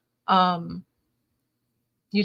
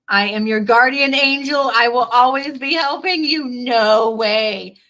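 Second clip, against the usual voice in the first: excited; 3 semitones higher, 8 dB louder than usual. Real, not acted. Reading card aloud, then reacting.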